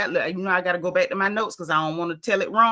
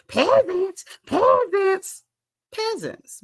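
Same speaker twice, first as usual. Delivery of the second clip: much higher, put-on haughty chant of peasants; mock queen; 10 semitones higher, much slower than usual. Host imitating Kenya dismissing castmates, high mock haughty voice. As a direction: sneer it down like a queen. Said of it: "she was like".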